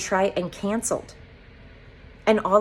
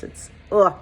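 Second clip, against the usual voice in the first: loud exasperated groan; sudden groan of frustration; frustrated ugh; 7 dB louder than usual. Real, not acted. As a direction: let out a fed-up ugh. Overwhelmed, breaks off.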